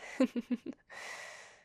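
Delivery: a short embarrassed whispered laugh; sheepish laugh. Acted. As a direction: laugh it off, a little flustered. Embarrassed after exaggerating.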